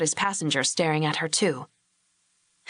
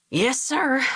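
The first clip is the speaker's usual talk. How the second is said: relieved rather than obedient; more relief than respect; glad to sit; 6 semitones higher than usual. A narrator's reading. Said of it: "more as if he were glad to sit".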